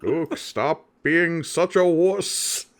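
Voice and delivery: put-on slow, grumpy old ghost; Obi-Wan voice. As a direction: scold like a cranky old mentor. Voicing ornery ghost Obi-Wan.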